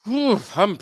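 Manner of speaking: talking through a yawn; sleepy yawn mid-sentence. Real, not acted. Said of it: "I'm yawning a lot".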